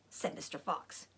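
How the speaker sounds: ordinary delivery, nothing marked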